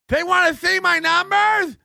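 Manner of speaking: high, loud mock outburst; mock ranting streamer. Mocking the streamer's outburst, high shrill rant.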